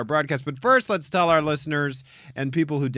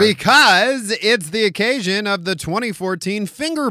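sportscaster voice; 7 semitones higher, 6 dB louder than usual. Pretend. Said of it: "[name]'s talking like a sportscaster".

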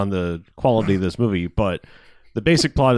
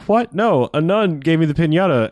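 high, loud startled what; mock outrage, pitch jumping up; shocked; 7 semitones higher, 4 dB louder than usual. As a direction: yelp it in disbelief. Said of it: "he's like".

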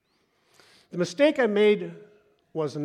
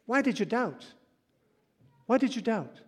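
higher, searching plea; pleading question; 6 semitones higher than usual. Acted. Preacher voicing Jesus, higher and pleading. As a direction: press the doubters gently.

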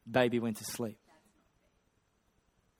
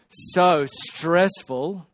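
dragged-out weary complaint; 3 semitones higher, 4 dB louder, much slower than usual. Retold or imitated. Carlene complaining wearily.

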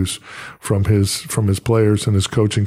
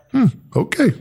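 reluctant okay; 12 semitones higher than usual. Retold or imitated. Reluctant agreement retold.

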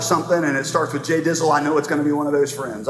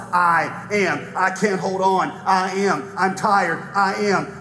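dramatic sermon reading; 5 semitones higher than usual. Acted. Preacher reading voices of the hurting, then God's answer. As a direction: answer every cry with a calm "I am".

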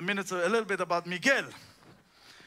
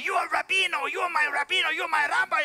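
put-on higher and louder, a mild Cuban accent; Miguel calling him rabbi; 6 semitones higher, 4 dB louder, faster than usual. Mechanic eagerly calling the preacher his rabbi, accented. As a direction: hail him as your rabbi with a Cuban lilt. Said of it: "he started to call me".

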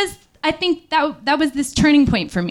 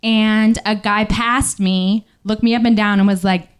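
ordinary talk, nothing marked

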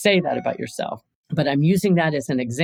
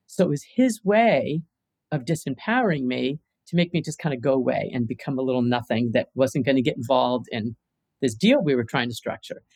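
ordinary talk

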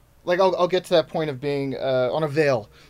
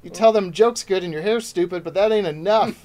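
higher, louder hammed-up showbiz dismissal; mock dismissive bookers; 5 semitones higher, 6 dB louder than usual. Booker dismissing comic's stand-up, mock dismissive. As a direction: play a booker who is unimpressed by stand-up. Mimics demanding industry people.

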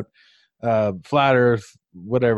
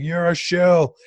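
mock accuser; 5 semitones higher than usual. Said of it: "he's like".

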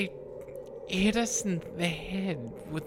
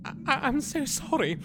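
crying; 7 semitones higher than usual. Acted. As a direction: blubber through a guilty confession. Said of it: "Now, now I'm crying".

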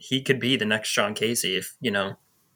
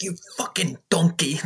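higher mock insult, Gordon Ramsay style; mock Ramsay rant; 7 semitones higher than usual. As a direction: tear into them like a TV chef. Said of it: "tearing into them".

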